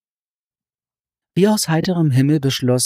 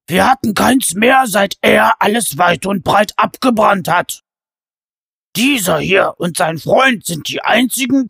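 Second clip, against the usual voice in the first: loud, high giant grumbling; a hungry giant pleading his case; complaining giant; 5 semitones higher, 6 dB louder than usual. A narrator's reading. Giant Kott complains of hunger.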